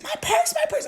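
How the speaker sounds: very high, panicked squeal; frantic shriek. Retold or imitated. Thrown-out girl shrieking for her purse.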